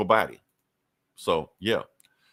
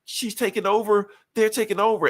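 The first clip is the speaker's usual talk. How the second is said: panicked warning; 12 semitones higher than usual. Retold or imitated. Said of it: "She's like".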